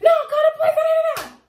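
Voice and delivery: very high, loud squawking imitation; mock insistent. Friend overruling her about the police, mock-insistent.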